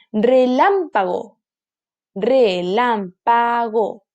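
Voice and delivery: slow Relámpago, lám leaned on; syllable by syllable. Shown as a demonstration. Teacher sounding out Relámpago, slowly. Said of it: "¿Dónde se carga?".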